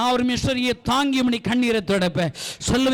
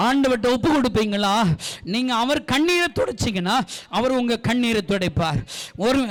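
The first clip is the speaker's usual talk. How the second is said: usual speaking voice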